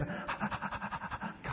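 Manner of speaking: rapid, breathy mock pants; imitated dog panting. Imitating the dog panting at the bed at midnight, fast pants. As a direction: pant like a restless dog. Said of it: "at the foot of the bed is".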